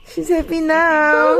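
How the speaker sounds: teary laugh. Real, not acted. Tearful reunion with her kids.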